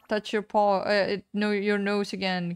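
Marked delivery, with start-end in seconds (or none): none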